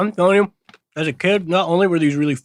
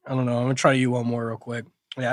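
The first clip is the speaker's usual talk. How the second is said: ordinary talk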